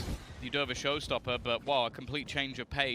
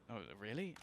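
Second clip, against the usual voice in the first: quiet; 4 semitones lower, 14 dB quieter, faster than usual. Retold or imitated. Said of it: "and R B goes".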